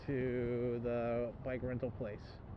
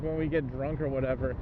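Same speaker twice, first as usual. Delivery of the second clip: ordinary talk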